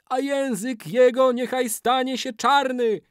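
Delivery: very high wailing incantation; ritual curse. A narrator's reading. Old man curses the betrayer's tongue, high wail. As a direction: chant the curse at the top of the voice.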